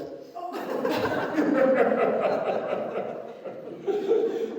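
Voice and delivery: real laugh at own joke, rising high; a burst of high airy laughter. Real, not acted. Preacher laughing at the punchline of his joke, high and airy.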